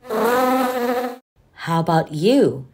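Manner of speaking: slow, coaxing question to little listeners; inviting question. Acted. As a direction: stretch it out to coax the children to try. Asking young listeners to join in.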